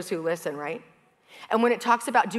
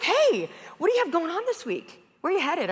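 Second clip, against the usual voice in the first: a bright, friendly barista voice, pitched higher; cheery barista; 7 semitones higher than usual. Retold or imitated. Barista greeting a customer, in a cheery, higher voice. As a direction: greet her like a regular you're glad to see. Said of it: "then he's like".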